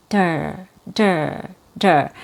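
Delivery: "der" low and neutral, with a fast d; a reduced "der", lips unmoved; weak syllable. Shown as a demonstration. Teacher isolating the weak end of "water", reduced. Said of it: "The weak syllable".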